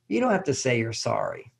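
normal talking voice

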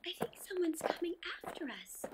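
frightened whisper. Acted. Gerda to the crow in the dark, whispering. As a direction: hush it as if followed. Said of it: "said Gerda".

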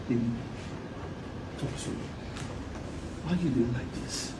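very soft, low pleading; low, subdued appeal to a partner; hushed plea. Acted. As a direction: plead almost under your breath. Pleading softly after being rejected.

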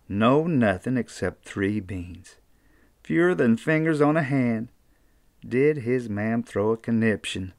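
plain reading voice

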